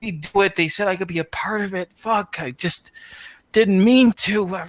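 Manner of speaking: high, whiny excuse-making; imitating his excuses. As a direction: plead high up like a cornered wretch making excuses. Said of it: "a lot of the stuff he says".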